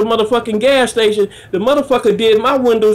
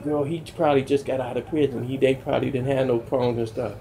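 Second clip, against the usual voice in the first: low, much softer imitated mother; hushed mama aside; 11 semitones lower, 10 dB quieter than usual. Mama explaining the ex-prisoner to her child, hushed. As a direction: drop it to a quiet, low aside.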